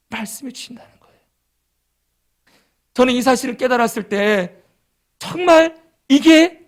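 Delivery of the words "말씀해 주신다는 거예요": whispered. Real, not acted. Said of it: "whispered aside".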